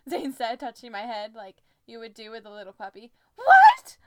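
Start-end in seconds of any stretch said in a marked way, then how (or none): 3.4-4.0 high, loud shocked outburst; near yell. A narrator's reading. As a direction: burst out in shrill disbelief. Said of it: "I almost yelled".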